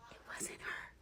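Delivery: hushed, spooked-story whisper. Real, not acted. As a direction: whisper it as the reveal. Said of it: "I'm whispering".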